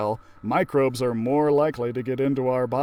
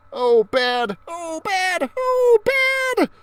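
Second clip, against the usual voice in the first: rising higher with each oh bad; panicky Carlos; 6 dB louder, much slower than usual. Carlos tossed into the washer, rising panic. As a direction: climb in pitch, more alarmed each time.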